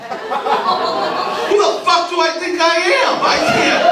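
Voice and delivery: high-pitched incredulous cry; self-mocking outburst. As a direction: wail in disbelief at yourself. Comic outrage at his own line.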